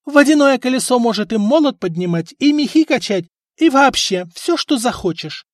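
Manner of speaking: condescending. A narrator's reading. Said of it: "снисходительно посмотрела на него жена".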